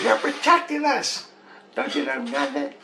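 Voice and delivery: high, put-on child voice; ten-year-old daughter. Daughter chiding dad, high mock child voice. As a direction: imitate a child scolding a parent. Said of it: "like a ten-year-old".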